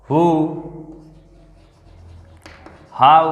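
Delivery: each wh-word said slowly and clearly on its own; teacher dictating question words; demonstrating wh-words. Shown as a demonstration. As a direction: model what, where, who, how, when one by one with long pauses. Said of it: "देखो, जैसे".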